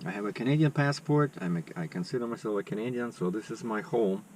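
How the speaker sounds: usual speaking voice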